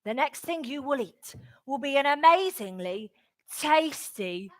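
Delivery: low, slowing hypnotist's voice; hypnotic suggestion. Acted. Sam hypnotising Feeney, low slow intoning.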